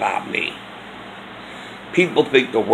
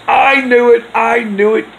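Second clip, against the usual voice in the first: loud, much higher accusing cry; ex-wife shouting her accusation; 12 semitones higher, 6 dB louder than usual. Retold or imitated. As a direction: hurl it at him like a caught thief. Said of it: "And she says".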